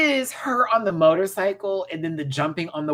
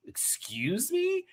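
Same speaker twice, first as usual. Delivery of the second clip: slow rising, high excuse me; drawn-out sassy excuse me; mock offended excuse me; 12 semitones higher, 6 dB quieter, much slower than usual. Real, not acted. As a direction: play it indignant.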